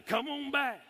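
high-pitched playful call. Pretend. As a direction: call it back from out there. Calls out to what was thrown.

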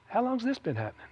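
mild, quiet curiosity; calm, unfazed. Retold or imitated. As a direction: ask it quietly, without any alarm. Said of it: "Just like that, you know".

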